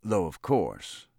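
slow, dignified concession. A narrator's reading. Governor adds to his rebuke, dignified. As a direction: draw it out with dignity. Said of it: "he added with dignity".

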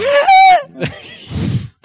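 high, loud strained creature howl; Chewbacca-style wail. Acted. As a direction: squeeze out a long high wookiee cry. เลียนเสียงร้องชิวเบคก้า. (เสียงโหยหวนแหลมสูงแบบชิวเบคก้า)

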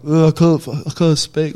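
blurting out in alarm that he can't speak; a son pleading with his dad in alarm; panicked plea. Retold or imitated.

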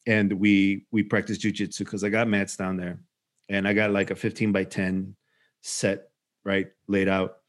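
ordinary delivery

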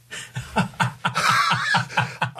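long, breathy burst of laughter; hearty laugh. Real, not acted. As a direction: crack up laughing at your own story. Laughing at his daughter's remark.

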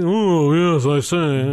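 upper-class drawl; snobby rich voice. Retold or imitated. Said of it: "you always go to the snobby rich voice".

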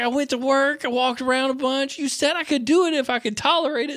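high, innocent little voice for the dad; imitating dad. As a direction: play the dad owning up sheepishly, pitched high. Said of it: "He's like".

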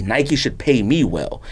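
ordinary talk

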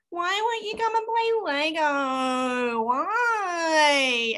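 put-on high, slow whining child; child whining at her sister; whiny child. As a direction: drag out the whine like a fed-up kid. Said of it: "appropriately whiny voice".

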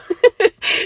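bright laugh, high and loud; high burst of laughter. Real, not acted. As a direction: let out a quick high laugh. Laughs at her own remark.

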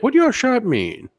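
high, suspicious shop-lady voice; imitating the donut lady. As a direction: quiz him like a judgy shopkeeper. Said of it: "she's like".

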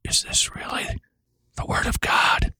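whispered voice of doubt; insidious, questioning whisper; whispered intrusive thought. Acted. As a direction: whisper it like a doubt creeping into the mind. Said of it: "You hear the voice that says".

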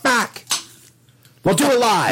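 high, loud mock outburst; hammed-up on-air rant. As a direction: yell it like the famous meltdown. Quoting famous rant.

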